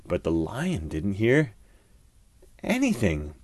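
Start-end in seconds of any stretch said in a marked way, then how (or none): none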